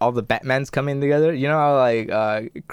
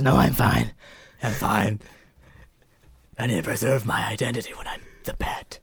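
hoarse growl, trailing softer; Bruce Wayne; 3 semitones lower, 3 dB quieter than usual. Retold or imitated. Billionaire refusing a lozenge, growled reply. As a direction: rasp it like you need a lozenge. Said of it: "you need a lozenge?".

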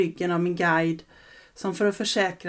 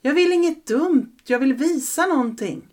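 put-on pleading boy; 7 semitones higher, 5 dB louder than usual.

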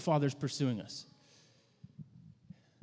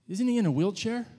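put-on child voice; 7 semitones higher, 5 dB louder than usual. Six-year-old boy asks.

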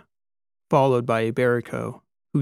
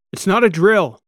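high, loud urgent shout; captain cuts off the question; 8 semitones higher, 9 dB louder than usual. A narrator's reading. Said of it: "Kikoa shouted".

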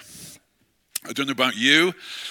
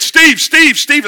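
much higher, louder calling of a name; 15 dB louder, much slower than usual. Retold or imitated. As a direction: call him urgently down the phone. Retold caller on the phone.

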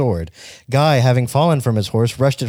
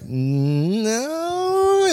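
flat refusal; 12 semitones higher than usual. Retold or imitated. Generals refusing.

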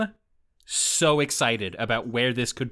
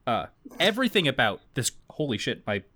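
ordinary delivery

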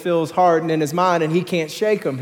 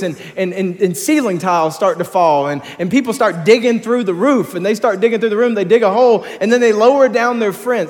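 higher, louder building sermon; preaching at full force; 5 semitones higher, 5 dB louder than usual. Real, not acted. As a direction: drive the story home with rising energy.

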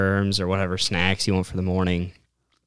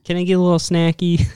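much higher, louder mimicking voice; imitating his companion wheedling; 12 semitones higher, 6 dB louder than usual. Hunter mimics her asking for a little snacky, high wheedling. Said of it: "she starts, like, yelling out the window".

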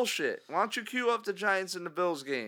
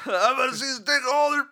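higher, louder hammed-up old fan, shouting; old diehard caller; 6 semitones higher, 6 dB louder than usual. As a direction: bellow it like a crusty season-ticket fan on the radio. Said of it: "whatever the hell this guy wants to say".

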